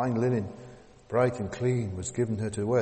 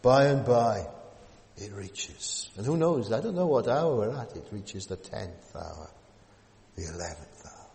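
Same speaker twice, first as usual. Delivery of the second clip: slow build, dropping to a whisper then rising; dramatic sermon climax; 4 dB louder than usual. Acted. Preacher warning the congregation of judgment, dramatic build.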